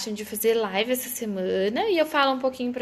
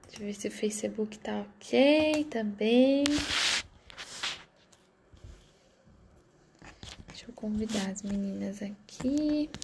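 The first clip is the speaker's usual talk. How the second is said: sussurro; 4 dB quieter than usual. Real, not acted. Side remarks while setting up stream.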